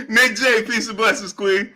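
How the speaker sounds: high voice broken by giggles; helpless laughter. Real, not acted. Host greeting Nick J, laughing uncontrollably. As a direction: greet someone while still cracking up. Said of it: "I can't stop laughing".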